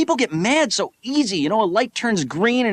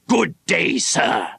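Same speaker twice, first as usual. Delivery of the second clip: prim dismissal; 3 semitones lower, slower than usual. Retold or imitated. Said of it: "give him that look and you say".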